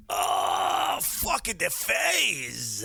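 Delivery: drawn-out, high hollered outburst; mock outburst. Host breaking off from his notes, mock holler. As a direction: holler it and stretch the last word. Outburst while fiddling with screen.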